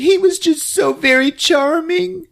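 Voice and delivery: very high tearful mock voice; squeaky pretend-weeping; crying woman. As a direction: imitate a woman crying as she talks. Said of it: "And she's all crying".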